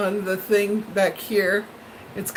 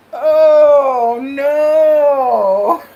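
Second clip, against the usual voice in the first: loud dismayed oh no; 4 semitones higher, 9 dB louder than usual. Retold or imitated. Viewer retelling price shock, loud dismayed wail. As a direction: wail "Oh, no" much louder, drawn out.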